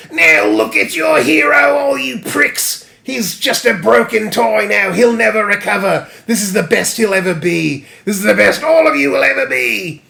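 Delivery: loud, high cartoon villain screech; taunting monkey man. Monkey Man jeering at the hero's followers, as a screeching taunt.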